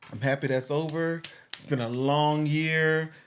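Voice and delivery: ordinary talk, nothing marked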